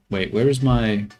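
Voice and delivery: ordinary delivery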